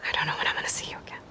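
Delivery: fast breathy whisper, holding back feelings; hushed rushed whisper. Acted. Aloy to Seika before parting, quick hushed whisper. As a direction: let it tumble out in a quick whisper.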